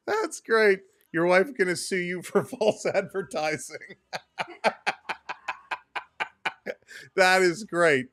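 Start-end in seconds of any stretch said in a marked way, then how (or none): 4.0-6.7 silent wheezing laugh. Real, not acted. Laughing at chat.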